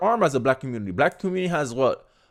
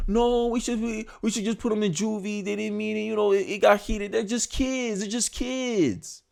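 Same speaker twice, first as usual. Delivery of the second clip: mock emotional pleading; 5 semitones higher, 4 dB quieter than usual. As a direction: plead softly and high, stretching the last word. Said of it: "Emotion says".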